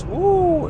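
very high, loud whoop; thrilled whoop. Real, not acted. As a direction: let out a roller-coaster "woo". Riding the dip.

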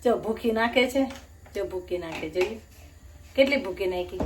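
normal talking voice

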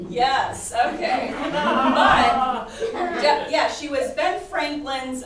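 laughing while answering. Real, not acted. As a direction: chuckle through the words.

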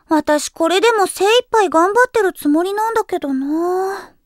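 ordinary talk, nothing marked

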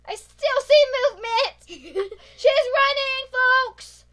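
high, loud laughing call; laughing shout. Real, not acted. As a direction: laugh while you shout it. Chasing the hider, laughing.

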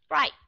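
"Bright" with the final T held back; unreleased T. Shown as a demonstration. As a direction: stop the T of "Bright" without a puff. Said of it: "dùng release hay là unrelease?".

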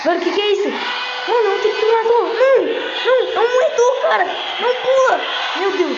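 panicked high screaming; screaming in terror; scared horror game streamer. Real, not acted.

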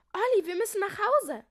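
high, loud, urgent call; alarmed cry. A narrator's reading. Julia urging Olli home, high alarmed shout. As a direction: call your brother in alarm. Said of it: "rief Julia erschrocken".

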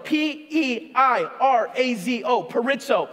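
P E I R A Z O spelled slowly letter by letter, then peirazo said as per-EYE-tso; spelling it out. Shown as a demonstration. Preacher teaching the congregation the Greek word, spelled slowly. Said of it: "the Greek word peirazo".

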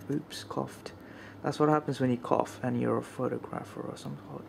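normal talking voice

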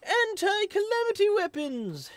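high, eager mad scientist; excited scientist voice. Acted. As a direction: read it like a giddy inventor. Reads Robbie's lines in voice.

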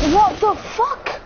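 slow, high, stunned what the fuck; high disbelieving swear; shocked exclamation. Real, not acted. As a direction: drag it out in disbelief. Shocked by something in game.

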